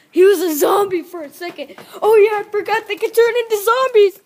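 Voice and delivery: loud, rising excited outburst; mock alarm. Soldier realising they turn into zombies, high and loud alarm. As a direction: blurt it out in play panic.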